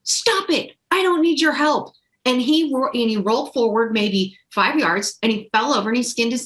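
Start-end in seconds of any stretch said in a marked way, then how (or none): 0.0-1.9 mock child snapping, much higher pitch; be the stubborn boy pushing mom away; imitated little boy's high-pitched refusal; defiant kid. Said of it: "he's like".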